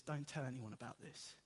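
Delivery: low, hushed caution to friends; quiet warning. Acted. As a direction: keep it down, as if sharing a secret. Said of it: "Jesus warns them".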